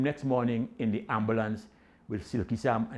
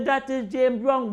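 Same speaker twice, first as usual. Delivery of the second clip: excited cheer; 12 semitones higher, 4 dB louder, slower than usual. Retold or imitated. Crowd cheering singer.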